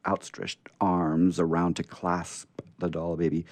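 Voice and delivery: ordinary delivery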